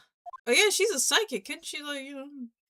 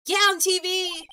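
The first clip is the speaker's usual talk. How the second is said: higher and louder; exasperated girl; 7 semitones higher, 6 dB louder than usual. Acted. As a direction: snap it back impatiently. Maya exasperated explaining.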